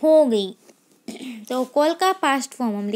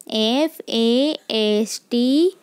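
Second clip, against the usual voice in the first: each letter spaced out slowly; slow dictation of letters; slow spelling; 2 semitones lower, much slower than usual. Shown as a demonstration. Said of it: "teacher spells word for class".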